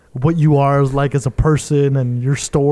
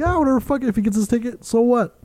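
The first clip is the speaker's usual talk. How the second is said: careless dismissive voice; 8 semitones higher than usual. Retold or imitated. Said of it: "He's like".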